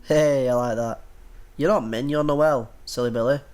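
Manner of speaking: normal talking voice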